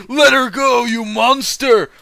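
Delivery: hammed-up hero yelling at the monster; shouted. Hero shouting at the villain holding Sakura, loud and high. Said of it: "I shouted".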